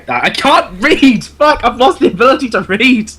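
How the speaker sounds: mock despair at oneself; exasperated outburst. Real, not acted. Reader stumbling over a definition, exasperated with himself. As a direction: break off in comic frustration, voice lifting.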